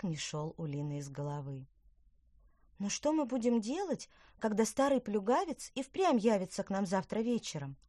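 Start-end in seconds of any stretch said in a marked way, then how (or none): 2.8-7.8 worried. A narrator's reading. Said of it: "озабоченно спросила она".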